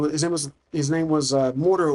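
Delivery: ordinary talk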